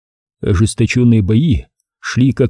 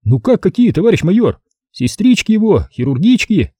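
higher, merry captain voice; jolly ladies' man, higher; cheerful Savelyev; 8 semitones higher than usual. A narrator's reading. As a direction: say it gleefully, pitched up. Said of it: "весело проговорил он".

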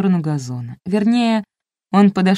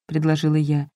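ordinary delivery

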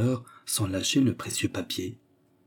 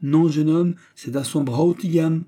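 high, louder, insistent voice; emphatic correction; 7 semitones higher, 4 dB louder than usual. Acted. Manager steering the suitor at the fiancé, insistent.

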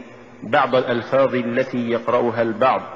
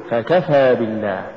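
فكفى with ف set apart and كفى pushed; push after fa. Shown as a demonstration. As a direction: lean on the ك and draw out فكفى. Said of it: "قل". (نطق فكفى بدفع الكاف بعد الفاء)

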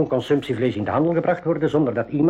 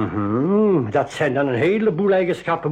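ordinary delivery

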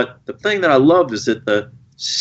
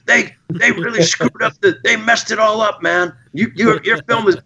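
put-on high, eager teenage fan voice; excited young fan; 8 semitones higher than usual. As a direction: gush like a starstruck teen fan. Fans gushing at the Q and A.

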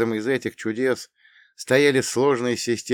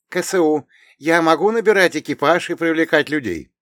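heroine voice; 8 semitones higher, 7 dB louder than usual. Acted. Irina voiced, higher and louder.

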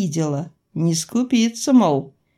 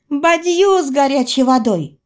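cutting in; 7 semitones higher, 4 dB louder than usual. A narrator's reading. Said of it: "встряла я".

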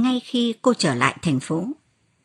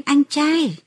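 mild, high glad call; joyful cry; 7 semitones higher, 3 dB louder than usual. A narrator's reading. Mother spots her brother at the gate, joyful cry. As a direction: call out in delight, lightly voiced. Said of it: "và reo lên".

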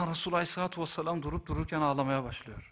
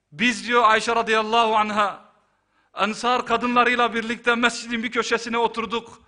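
loud, raised-pitch sermon delivery; impassioned preaching; 7 semitones higher, 10 dB louder than usual. Acted. Preacher relays Aisha's account of mourning Hamza, impassioned preaching. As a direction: recount it from the pulpit with full force.

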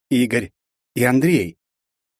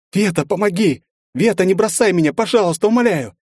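high, loud pained screams; begging for help under torture; screaming in pain; 10 semitones higher, 4 dB louder than usual. Acted. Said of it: "Марина закричала от боли". (крик от боли)

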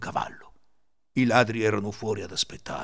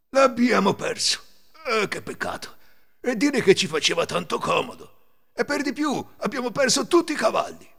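high, loud mock lament; 11 semitones higher, 5 dB louder than usual. A narrator's reading. Tired thieves lamenting lost Cecino and horses, acted dismay. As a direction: bemoan the loss, theatrically. Ladri si lamentano della perdita.

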